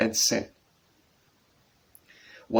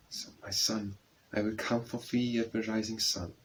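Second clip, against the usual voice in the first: much softer, hushed mother's promise; low voice; 6 dB quieter than usual. Acted. Mother promises her grieving son she will come, soft and hushed. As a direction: hush it down, tender and calm. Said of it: "his mother's voice said low".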